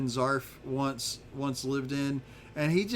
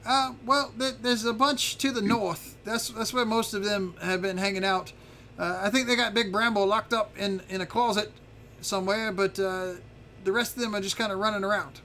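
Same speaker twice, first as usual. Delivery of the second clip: higher-pitched character voice; chatty little dragon informant; 6 semitones higher than usual. Acted. Little dragon telling visitors where the intruders are, higher chatty voice. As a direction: play a helpful dragon, voice pitched up.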